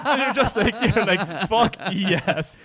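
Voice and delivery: laughing loudly through the words; cracking up while telling it. Real, not acted. Laughing retelling the phone call.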